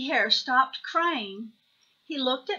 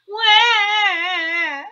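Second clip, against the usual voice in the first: put-on high, loud baby bawl; acted childish crying; hammed-up wail; 9 semitones higher, 11 dB louder than usual. A narrator's reading. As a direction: wail it out long. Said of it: "he wailed".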